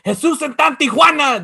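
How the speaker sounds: Spanish catchphrase. Retold or imitated. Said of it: "he goes".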